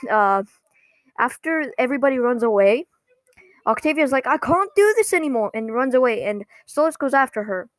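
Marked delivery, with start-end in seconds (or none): 4.3-5.5 higher-pitched mock exasperation; lightly acted upset cry; fed-up outburst. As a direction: imitate a teenager who has had enough. Acting Octavia's fed-up outburst.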